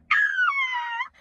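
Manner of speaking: very high, drawn-out scream of delight; swooning squeal. Acted. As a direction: fangirl shriek. Squeal at being called love.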